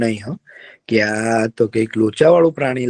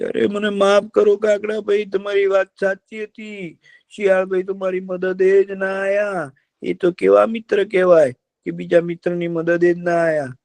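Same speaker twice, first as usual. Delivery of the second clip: high, apologetic deer voice; a remorseful deer begging pardon; sorry deer; 8 semitones higher than usual. Acted. (ઊંચા અવાજે માફી માગતું હરણ)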